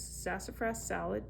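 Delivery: ordinary delivery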